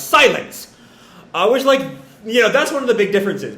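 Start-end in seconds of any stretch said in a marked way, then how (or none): 0.0-0.7 raised, higher-pitched cry of silence; a short retold yell; mock yell. Momo cutting everyone off, mock yell. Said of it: "just yells".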